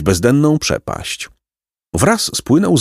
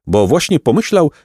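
usual speaking voice

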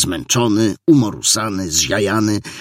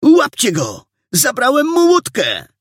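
very high, louder urgent shout; alarmed cry; 4 dB louder than usual. A narrator's reading. Boy urges police to catch the suspect, alarmed. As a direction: call everyone to action, high and urgent. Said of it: "zawołał alarmującym głosem".

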